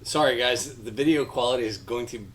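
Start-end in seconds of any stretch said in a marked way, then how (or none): none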